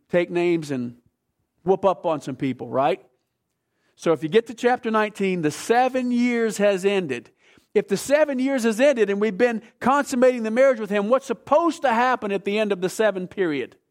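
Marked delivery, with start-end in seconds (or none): 4.0-13.8 raised, louder preacher's delivery; emphatic preaching. Real, not acted. Preacher asks congregation about the seven years' end, emphatic preaching. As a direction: drive the teaching home with punchy stresses.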